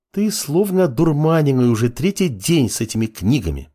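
high-pitched woman's complaint; complaining. A narrator's reading. Girl to apprentice buried in books, complaining. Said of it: "пожаловалась девушка".

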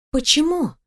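high, puzzled question; mild astonishment; surprised. A narrator's reading. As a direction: ask with lifted, wondering pitch. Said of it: "удивилась я".

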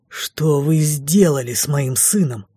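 slow, threatening demand; slow, menacing accusation; menacing near-hiss. A narrator's reading. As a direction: press each word out as a restrained threat. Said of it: "угрожающе навис и почти прошипел мне в лицо".

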